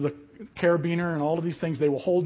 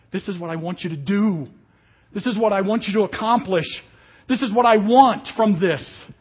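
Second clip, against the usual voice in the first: demanding; 4 semitones higher, 7 dB louder than usual. Acted. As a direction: build from firm to loud and insistent. Acted demanding prayer, building.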